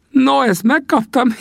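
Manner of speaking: put-on high old trapper voice breaking into laughter; grinning laugh. Old Sam laughs off the greenhorn's jab with a high cackle. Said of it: "vigyorgott Sam".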